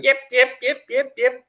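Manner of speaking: rapid high, loud yips; imitated yipping. She answers the staff's call, imitated high yipping. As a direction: yip excitedly in a high voice. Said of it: "And I hear seven".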